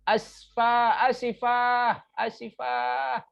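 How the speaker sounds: high, stretched-out lament; hammed-up regret, drawing out the endings; sorrowful apology. Shown as a demonstration. Teacher demonstrating an exclamation of sadness, sorrowful apology. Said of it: "eh, sedih ya".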